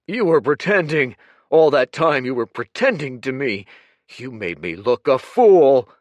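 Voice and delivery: loud, high, raging accusation; furious shouting. Acted. Humiliated Kuruk shouting down Dorian, furious. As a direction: rage at the betrayal.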